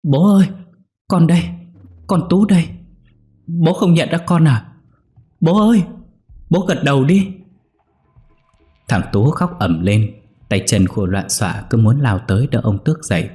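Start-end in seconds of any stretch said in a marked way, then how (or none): 0.0-7.3 put-on a terrified boy bawling and begging; wailing child. A narrator's reading. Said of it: "gào khóc cứ kêu ầm lên".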